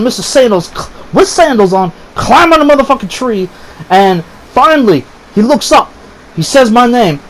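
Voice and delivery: usual speaking voice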